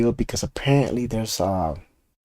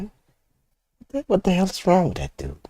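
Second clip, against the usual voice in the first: exasperated; 8 semitones higher than usual. Real, not acted. Exasperated at Tesla dealer.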